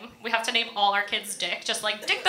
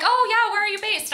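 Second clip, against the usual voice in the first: high, loud bright phone voice; high, loud imitation of a cheery caller; chirpy caller; 10 semitones higher, 4 dB louder than usual. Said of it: "And she's like".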